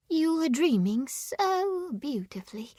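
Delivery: high, soft, wistful voice; hushed, dreamy admiration; dreamy murmur. A narrator's reading. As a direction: say it softly, as if entranced. Mariel murmurs about his dreaming.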